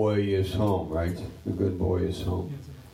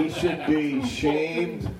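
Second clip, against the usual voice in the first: slow, amused mock scorn; a half-joking call for shame; laughing mock scorn; 6 semitones higher, much slower than usual. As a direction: laugh through a pretend scolding.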